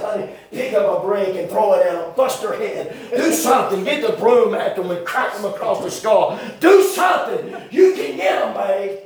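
rising laughing shout at his wife; half-laughing mock battle cry; urging yell. Telling wife to hit boys.

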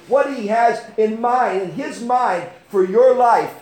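high, loud testimony from the pulpit; impassioned preaching. Real, not acted. Preaches with raised voice.

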